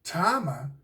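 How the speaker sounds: ordinary delivery, nothing marked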